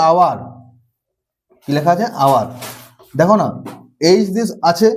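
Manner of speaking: normal talking voice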